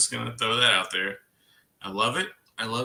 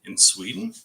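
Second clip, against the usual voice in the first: ordinary talk, nothing marked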